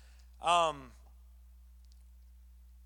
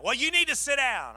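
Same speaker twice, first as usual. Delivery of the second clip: higher, louder firm urging; insistent caller; 6 semitones higher, 5 dB louder than usual. Retold or imitated. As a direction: Linda telling the pastor, higher firm urging. Linda insisting on phone.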